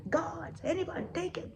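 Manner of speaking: high, flustered royal ranting; outraged king. Acted. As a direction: rant as a tyrant, dissolving into nonsense syllables. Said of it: "while the king is outraged there".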